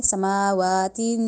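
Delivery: سماواتن held, the ن carried through the nose; nasal tanween. Shown as a demonstration. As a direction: hold سماواتن and hum the end nasally. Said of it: "نون کی sound ہم ناک میں لے کے گئے".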